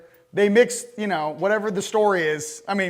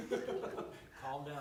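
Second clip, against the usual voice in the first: laughing at himself; 4 semitones higher, 19 dB quieter than usual. Real, not acted. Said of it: "I'm getting a little heated".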